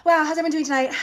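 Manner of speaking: mock out-of-breath superstar, high and loud; fake breathlessness. Singer faking exhaustion to greet the crowd, mock breathless. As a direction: gasp theatrically, then pant out a loud, high greeting. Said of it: "she would do that fake".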